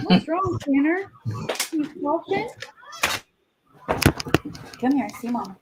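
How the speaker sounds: high cooing to a pet; talking to a dog. Real, not acted. Mom fussing over dog.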